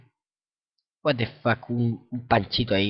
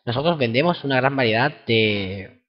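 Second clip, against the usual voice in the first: ordinary delivery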